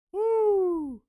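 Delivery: high excited woo; excited whoop. Real, not acted. Cheers at the clip.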